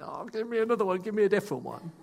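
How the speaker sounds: flustered self. Retold or imitated. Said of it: "I'm like".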